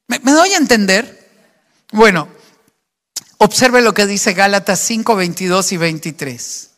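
normal talking voice

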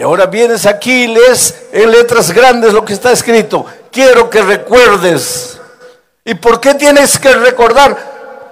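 high, loud pulpit preaching, every phrase driven hard; preaching at full force. Acted. Pastor warning the stadium crowd, loud fervent preaching. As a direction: thunder the warning at the whole stadium.

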